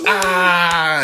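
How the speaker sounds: high, loud mock shriek of pain; beast's pained yell. The speared beast Cain, a loud high mock yell. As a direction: yelp like the struck beast. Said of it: "and Cain goes".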